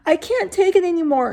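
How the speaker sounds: high, loud panicked wail. Acted. Panicking boy wailing as the banging grows. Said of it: "he wailed".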